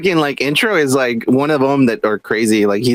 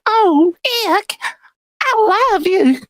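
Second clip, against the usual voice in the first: high, breathy Southern drawl, imitating; a lovestruck Southern lady, hammed up; Southern accent, mock cartoon girlfriend; Southern belle voice; much slower than usual. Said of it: "she's Southern as fuck. And she's like".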